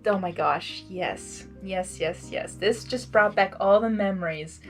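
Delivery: usual speaking voice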